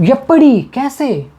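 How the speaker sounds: playful shocked scolding; acted shock. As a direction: react as if someone just broke the glass. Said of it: "मान के चलो ... कांच को तोड़ दिया".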